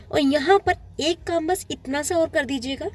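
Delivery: normal talking voice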